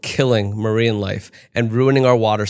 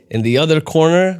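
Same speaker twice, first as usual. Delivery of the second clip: ring announcer; 6 semitones higher, 4 dB louder than usual. Pretend. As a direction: boom it out like a boxing ring announcer.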